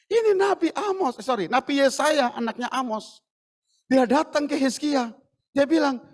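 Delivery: normal talking voice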